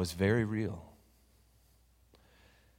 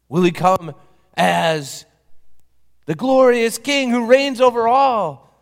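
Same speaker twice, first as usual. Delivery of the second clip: rising, louder, grand questions; preaching; 10 semitones higher, 11 dB louder than usual. Acted. Preacher asking how Jesus will come, building grandly. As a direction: build the image to full force.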